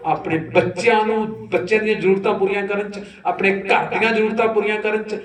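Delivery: high, insistent preaching; forceful preaching. Real, not acted. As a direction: hammer out the list to the congregation. Preacher raises voice listing worldly cares.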